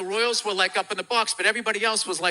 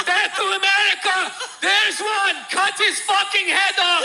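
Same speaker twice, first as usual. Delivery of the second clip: high, loud hammed-up chant; mock militant shouting; 12 semitones higher, 4 dB louder than usual. Imagined gunmen in the crowd, mock shouting high and loud. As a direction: yell it like a cartoon gunman. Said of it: "shooting machine guns in the air, going".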